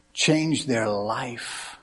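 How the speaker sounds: Change their life drawn out very slowly, each word weighed; slow emphatic repeat. Real, not acted. Repeated sermon line.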